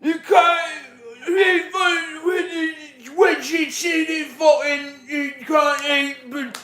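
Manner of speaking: very high, loud, slurred and slow. Acted. Streamer doing impressions on request, slurred shouted rock-star impression. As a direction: shout it garbled, words smeared together.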